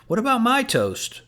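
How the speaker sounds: high, whining mock complaint; mock whine. A narrator's reading. Coach playfully whining to the players for his toast. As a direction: whine it, not really upset. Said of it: "whined Coach".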